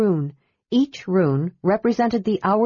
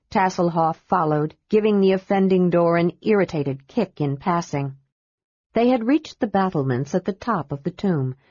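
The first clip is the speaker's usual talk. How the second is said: ordinary delivery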